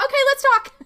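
high, bright and loud, eager to talk; God's cheerful reply. Retold or imitated. God welcoming her back after repenting, bright and eager. God voiced in a retold exchange.

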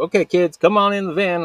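put-on high, loud sing-song call to kids; imitated aunt and uncle. Uncle and aunt herding kids to the van, imitated call. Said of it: "uncle and aunt come over".

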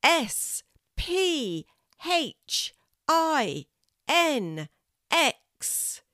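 loud, very slow letter by letter, "S P H I N X"; spelled out loud. A narrator's reading. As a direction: spell each letter out high and proud. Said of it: "in her loudest voice".

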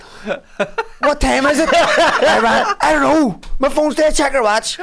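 high, loud laughing retelling; retelling the oversleeping chaos; laughing panic. Retold or imitated. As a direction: act the panic while laughing. Retold panicked exchange, laughing.

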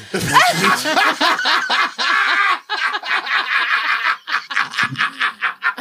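loud burst of laughter at the joke; roaring laugh. Real, not acted. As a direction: let a big shouting laugh roll out. Big laugh at the punchline.

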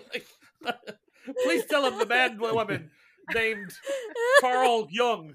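high, loud, helpless laughing delivery. Real, not acted. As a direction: struggle to get the words out through laughter. Game master cracks up at disguise.

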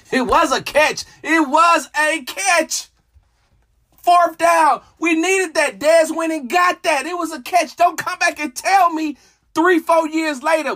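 high-pitched, indignant fan rant; outraged protest. Real, not acted. Fan still disputing a playoff call, outraged and insistent. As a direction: argue the bad call as if it just happened.

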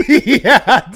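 high burst of laughter; laughing. Real, not acted. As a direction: laugh out loud.

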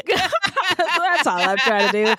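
slow words through laughter; laughing. Real, not acted. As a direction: laugh as you say it.

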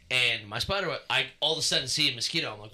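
usual speaking voice

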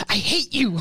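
high mock-kid voice; resentful son. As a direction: imitate a woken child lashing out. Acting son answering drunk dad.

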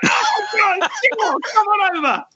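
very high, hammed-up excited cry; starstruck squeal. Told the friend is Lee Dixon, ecstatic. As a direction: act out losing all composure with delight.

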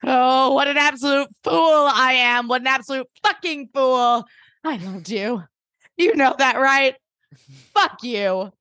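mock anguish, starting high and emphatic; hammed-up heartbroken soap monologue; imitated tearful outburst. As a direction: play wounded betrayal, pausing between jabs. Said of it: "he starts crying".

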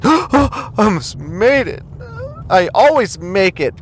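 high, slow, hammed-up disbelief; mock shocked player. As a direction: wail it like a stunned striker. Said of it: "then you put your hands to your face".

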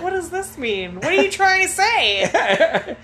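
high-pitched, slowing indignant question; mock offended. Receiver of a bidet questioning the gift, mock offended. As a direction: demand an explanation, hammed-up and high. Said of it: "genuinely offended initially".

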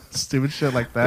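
normal talking voice

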